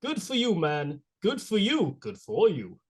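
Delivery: a mock Walken that comes out like Bernie; Christopher Walken impression. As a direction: do your best Walken, pausing oddly. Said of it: "doing that impression".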